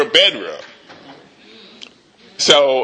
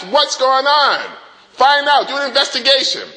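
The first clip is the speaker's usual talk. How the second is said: enraged king; 6 semitones higher than usual. Acted. King of Aram demanding his officers find the spy.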